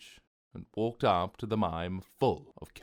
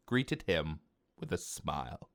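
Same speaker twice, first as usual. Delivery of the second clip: usual speaking voice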